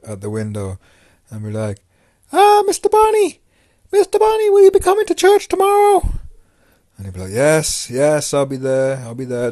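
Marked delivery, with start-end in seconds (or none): 2.3-6.1 very high, loud little Irish nun; shrieky Irish woman impression, calling through; Irish accent. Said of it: "I give her an Irish accent 'cause she did have one". 7.3-9.5 placating reply. Retold or imitated. Acted reply to the calling nun.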